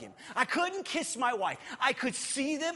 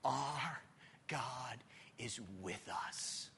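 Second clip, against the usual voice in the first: much lower and softer; hushed reverence; 8 semitones lower, 10 dB quieter, slower than usual. Acted. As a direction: let each word land quietly.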